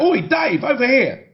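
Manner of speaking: high, drawn-out calling out; mock shout. A narrator's reading. Paps hailing the star at premieres, high mock shout. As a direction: call across a crowd, pitched right up. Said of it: "we all shout".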